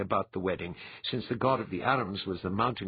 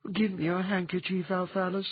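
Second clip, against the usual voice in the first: much higher feminine voice with a slight sniff; sniffling Dwyer; 10 semitones higher than usual. A narrator's reading. Dwyer tearing up at the wedding, higher voice with a slight sniff. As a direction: ask for the hankie as tears come. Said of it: "sniffing slightly".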